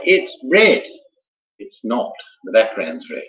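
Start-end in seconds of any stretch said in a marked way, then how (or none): 0.0-0.8 quoted claim. Retold or imitated.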